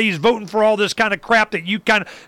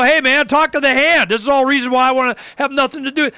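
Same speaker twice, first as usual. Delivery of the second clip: higher, louder, cocky talk to the hand; defiant rebuff; 8 semitones higher, 3 dB louder than usual. Retold or imitated. Telling the campaign people at the airport off, brash. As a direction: shut them down, brash and dismissive.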